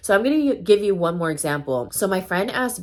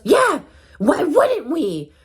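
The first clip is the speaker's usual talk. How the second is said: loud, high, drawn-out mock snap; snappy retort; 9 semitones higher, 6 dB louder, much slower than usual. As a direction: imitate an indignant grandma snapping back. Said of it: "she just, like, straight snapped".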